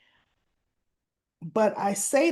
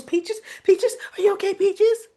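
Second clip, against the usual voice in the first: much higher, louder frantic calling; panicked cry for help; 11 semitones higher, 6 dB louder than usual. Retold or imitated. Leslie, scared, calling for help as Peaches chokes, panicked. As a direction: frantically call for a doctor. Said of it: "coughing and coughing".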